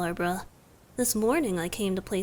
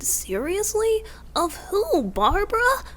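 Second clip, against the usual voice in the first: incredulous surprise; 10 semitones higher, 4 dB louder than usual. Acted. Surprised listener is jealous.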